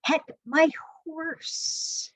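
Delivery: ordinary talk, nothing marked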